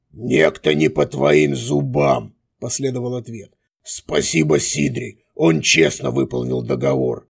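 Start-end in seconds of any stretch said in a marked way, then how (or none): 0.1-2.4 sneering villain. A narrator's reading. Villain replies. 3.8-7.3 sneering villain. A narrator's reading.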